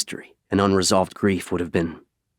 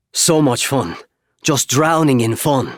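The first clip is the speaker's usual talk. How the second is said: sarcastic; 6 semitones higher than usual. Acted. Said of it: "he muttered, rolling his eyes".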